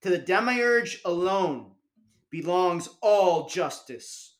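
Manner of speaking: slow, loud proclamation; a slow, loud, solemn shout. A narrator's reading. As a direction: proclaim it slowly to the crowd. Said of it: "I shouted".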